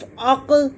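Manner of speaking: "अक" ending in a short rebound; qalqalah on qaf. Shown as a demonstration. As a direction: release the throaty q of "अक" with an echo. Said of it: "इसको हिलाया जाएगा".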